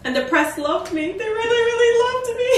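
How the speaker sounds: high, loud, fast laughing boast; gleeful high-pitched laughing; laughing brag. Real, not acted. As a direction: giggle through the brag. Bragging about press, laughing.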